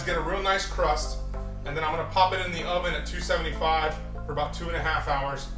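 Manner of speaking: normal talking voice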